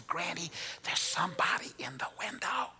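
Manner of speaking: breathy whisper with a rising end; scared whisper. Retold or imitated. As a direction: whisper it urgently, so no one else hears. Brother whispering to granny again.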